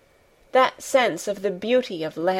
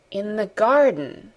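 dreamy repeat; slower than usual. A narrator's reading. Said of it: "he said".

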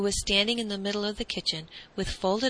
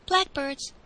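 lightly voiced boy, higher pitch; higher-pitched simple reply; boy's answer; 7 semitones higher than usual. A narrator's reading.

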